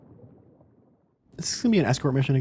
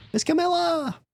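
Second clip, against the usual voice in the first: much higher, eager puppy call; little dog voice; slower than usual. Acted. Small dog calling for his mistress, high and eager.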